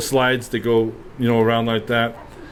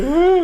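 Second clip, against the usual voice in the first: a short, much higher mock gasp of shock; startled gasp. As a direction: gasp high like a startled mom. Mom sees the bleeding child.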